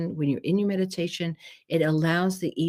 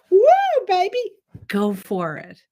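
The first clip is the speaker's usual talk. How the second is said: cheering; 13 semitones higher, 9 dB louder than usual. Acted. Cheering on dancing.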